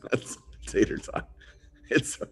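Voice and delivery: breathless through laughter; laughing. Real, not acted. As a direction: squeeze the words out while laughing. Still laughing at the comment.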